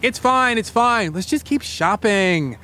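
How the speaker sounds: high, lightly acted shout back; mock shout. Host voices girl thief reassuring her accomplice, high mock shout. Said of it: "she shouts back".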